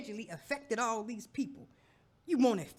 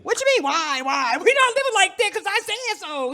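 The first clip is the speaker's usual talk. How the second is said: much louder, higher, shouting the rules; loud indignant wife; 7 semitones higher, 13 dB louder than usual. Acted. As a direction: put your foot down hard. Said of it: "I said it like that".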